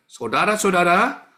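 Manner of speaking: loud, slow, commanding call to the crowd; booming address. Retold or imitated. Preacher calling to a crowd of thousands, loud and slow. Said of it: "lemah lembutnya ... kayak Pak Mulia".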